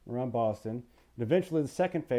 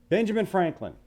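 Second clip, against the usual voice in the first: usual speaking voice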